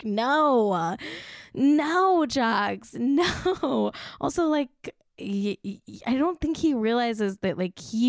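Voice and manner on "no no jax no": exasperated no. Real, not acted. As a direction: scold him in disbelief. Scolding Jax, mock exasperation.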